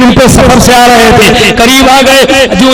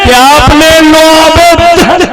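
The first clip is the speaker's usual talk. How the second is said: high, slow, drawn-out climax; exalted proclamation; 6 semitones higher, slower than usual. Real, not acted. Preacher quoting the scholar's account, exalted proclamation. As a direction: stretch the key words high for the crowd.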